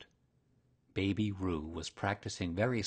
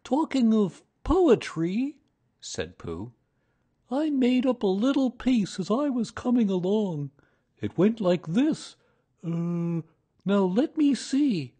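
Pooh voice; 12 semitones higher, 8 dB louder than usual. A narrator's reading. Pooh begins his poem.

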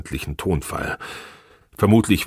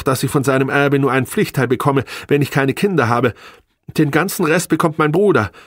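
high, agitated self-defence; upset protest; 10 semitones higher, 4 dB louder than usual. A narrator's reading. Wife defending herself about the marriage contract, high and upset. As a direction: argue back in a high, rising voice.

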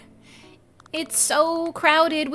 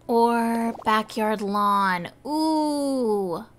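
ordinary talk, nothing marked

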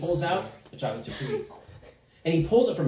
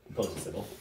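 normal talking voice

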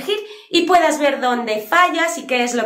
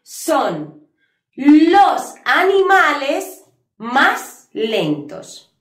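slow emphatic model; 3 semitones higher, 4 dB louder, much slower than usual. Shown as a demonstration. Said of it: "Lo que queremos expresar".

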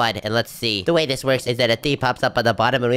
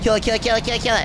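combat callout; 9 semitones higher, faster than usual. Real, not acted. Urgent fight calls at dragon.

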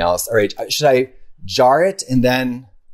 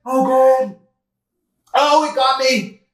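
high, breathless after the scare; shaken; 11 semitones higher, slower than usual. Real, not acted. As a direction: shaken and squealing. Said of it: "that just gave me a chill".

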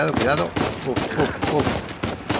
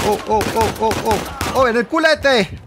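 excited shouting; 6 semitones higher, 4 dB louder than usual. Real, not acted. Hitting enemy, excited.

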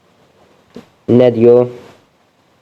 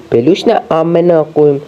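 slow, high, incredulous question; puzzled, drawn-out complaint at the game; baffled; 5 semitones higher than usual. Real, not acted. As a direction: drag it out in disbelief. Confused by game instruction.

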